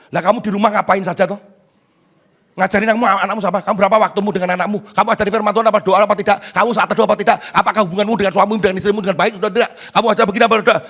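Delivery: fast, insistent volley of questions; rapid-fire interrogation. Acted. Preacher turns parents' complaint back on them, rapid-fire grilling. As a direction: grill them without pausing for answers.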